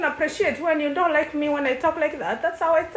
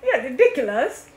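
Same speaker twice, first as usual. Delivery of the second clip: ordinary talk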